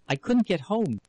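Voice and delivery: breathless high exclaim. A narrator's reading. Wife exclaims to husband, high and upset. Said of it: "she exclaimed".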